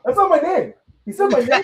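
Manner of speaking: outraged hero correcting the announcer; indignant. Retold or imitated. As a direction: squeak it out, offended at the wrong name. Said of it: "He was indignant".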